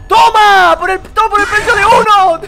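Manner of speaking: very high, very loud cracking yell; real screaming outburst. Real, not acted. Gamer hit by a jumpscare, hysterical shouting. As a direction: scream it at the game.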